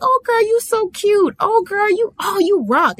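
butter you up like a fake friend; fake friendly girl. Mocking the sketchy friend who flatters you, in a high gushing voice. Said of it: "Sugar is that sketchy girl that pretends to like you".

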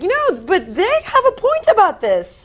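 high, loud torn inner voice. Retold or imitated. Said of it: "and I'm like".

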